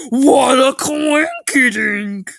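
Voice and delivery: high, slow, loud goofy exclamation; mock amazement. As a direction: ham up the surprise. Character marvels at coincidence.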